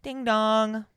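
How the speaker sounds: high sing-song doorbell chime; doorbell imitation. Host faking the segment's sound effect, doorbell imitation. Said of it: "We're gonna add a sound".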